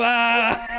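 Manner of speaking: high mock scream of terror; hammed-up frightened yelp; terrified cry. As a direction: cry out as if scared witless. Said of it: "He gets really terrified".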